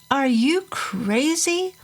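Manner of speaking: high, slow, shocked cry; alarmed outburst. Acted. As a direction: gasp it out in disbelief. Mom interrupts drunk dad's plan.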